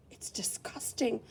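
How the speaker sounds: whispered disgust. Real, not acted. Teacher reacting to a littered page, whispered disgust. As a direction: breathe it out in disgust.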